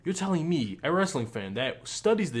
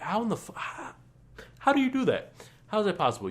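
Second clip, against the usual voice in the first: incredulous outburst; 9 semitones higher, faster than usual. Real, not acted. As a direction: demand an answer, pitched up. Ranting in disbelief.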